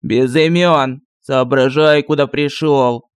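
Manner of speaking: put-on low, slow, scolding old sorcerer; stern sorcerer. A narrator's reading. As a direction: bark it out like a grumpy wizard. (строгий голос колдуна)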